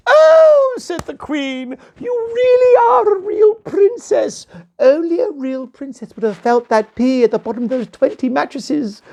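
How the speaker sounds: very high, loud delighted exclamation; triumphant queen. Acted. Queen proving the princess real, very high and triumphant.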